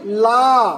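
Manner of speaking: very slow, stretched ला; teacher stretching the syllable ला from गिलास; drawn-out syllable la. Shown as a demonstration. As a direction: draw out ला so each sound is heard. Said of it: "ला बोलने में कितनी ध्वनियाँ".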